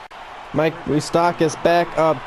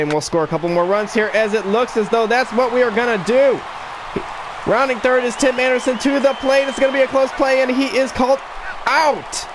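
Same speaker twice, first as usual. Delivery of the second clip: excited; 7 semitones higher than usual. Acted. Close play at the plate.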